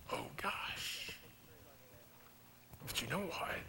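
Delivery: breathy whispered "oh gosh"; whispered sigh. Real, not acted. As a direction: let it slip out as a whisper. Emotional aside about missing him.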